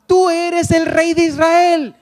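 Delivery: slow, loud declaration of the king; ringing proclamation. Acted. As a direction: proclaim it like a herald announcing a king. Preacher proclaims Natanael's confession.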